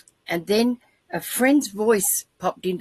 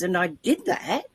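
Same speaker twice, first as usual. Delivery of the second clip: ordinary talk, nothing marked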